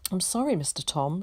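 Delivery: plain reading voice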